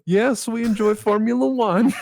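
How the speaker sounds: put-on high, loud foreign-accented English; hammed-up foreign accent, pitched high and loud; second-language English accent. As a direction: imitate an eager non-native English speaker. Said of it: "it's English as a second language".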